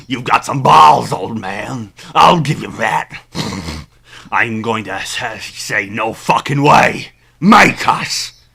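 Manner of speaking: loud, taunting pig-man thug. Acted. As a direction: jeer like a brutish pig. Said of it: "you gotta do a pig voice".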